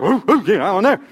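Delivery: lightly voiced hyper dog; 1 eager dog. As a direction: play an overexcited dog. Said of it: "'Cause dog is like".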